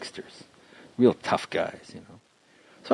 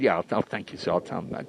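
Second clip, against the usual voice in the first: softer, lower nervous yes sir; scared politeness; 4 semitones lower, 6 dB quieter, faster than usual. Retold or imitated. Comic promising the mob guy he'll pass on the message, softly and scared. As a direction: mumble a frightened promise to the mobster. Just, just scary.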